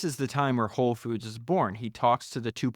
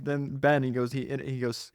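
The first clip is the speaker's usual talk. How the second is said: ordinary talk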